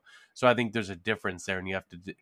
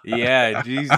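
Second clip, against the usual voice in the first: ordinary talk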